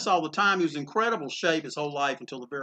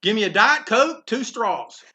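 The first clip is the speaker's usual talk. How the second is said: loud and higher, hailing the vendor; calling out an order; 6 semitones higher, 6 dB louder than usual. Retold or imitated. Eisner orders loudly from the push cart vendor, calling out. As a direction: call the order over the counter.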